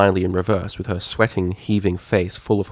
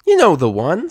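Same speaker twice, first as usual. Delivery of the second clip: chirped; 10 semitones higher, 7 dB louder than usual. Acted. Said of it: "Sweetie chirped".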